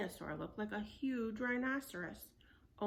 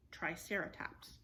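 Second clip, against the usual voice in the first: ordinary delivery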